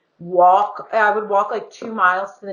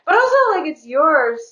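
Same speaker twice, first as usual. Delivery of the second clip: sudden high, louder protest; getting defensive; 8 semitones higher, 3 dB louder than usual. Real, not acted. To her friend about her posts, defensive. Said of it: "I'm getting defensive".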